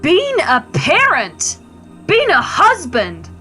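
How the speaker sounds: slow, emphatic scolding with swooping pitch; exasperated reproach. Acted. Wife berating her husband over his duties, exasperated. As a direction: hammer home each duty, drawn out.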